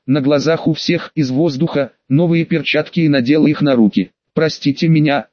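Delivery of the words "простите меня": ordinary delivery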